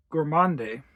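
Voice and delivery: usual speaking voice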